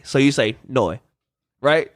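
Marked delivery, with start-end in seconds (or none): 0.7-1.0 "noi" said as a low oy diphthong; Australian "no". Shown as a demonstration. Explaining how Australians say no, "noi" rhyming with boy. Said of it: "with an Australian accent, it's like saying N O Y".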